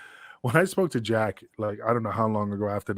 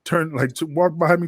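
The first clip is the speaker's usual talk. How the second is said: normal talking voice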